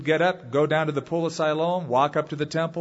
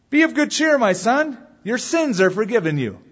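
hearty proclamation; 8 semitones higher, 6 dB louder than usual. Acted. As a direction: proclaim it to the man being forgiven. Preacher voices Jesus addressing the paralytic.

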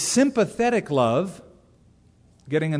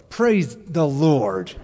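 slow, begrudging praise; grudging praise; 4 dB louder, much slower than usual. Pretend. Preacher acting a jealous, reluctant reply, slow grudging praise. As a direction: drag it out reluctantly. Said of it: "What's your response?".

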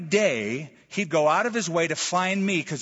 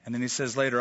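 ordinary talk

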